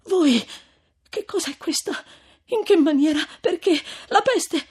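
high, loud, halting questions; astonished high female character; shocked disbelief. A narrator's reading. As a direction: fire off bewildered questions in pieces. Lucia shocked at finding Renzo.

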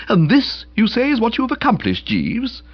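high, amazed build-up; incredulous. A narrator's reading. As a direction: ask as if witnessing a marvel. Boko incredulous at the miracle.

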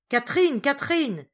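loud, high shout of a name. A narrator's reading. Solange takes over calling the missing servant, shouting loudly. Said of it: "cria mademoiselle Solange".

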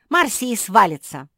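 loud, high exasperated order; impatient outburst. A narrator's reading. Куки telling Тео to shake the tree, impatient outburst. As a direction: burst out, out of patience. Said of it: "не выдержала Куки".

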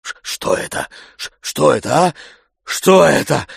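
gasping. A narrator's reading. Tarantyev slapped on the cheek, gasping in shock. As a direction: choke on the words in stunned shock. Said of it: "задыхаясь, говорил он".

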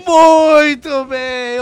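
very drawn-out, high and loud; showman's approval. Acted. Host hailing the announced theme, booming showman voice. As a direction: stretch it out like a TV host.

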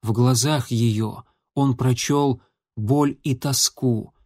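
ordinary delivery, nothing marked

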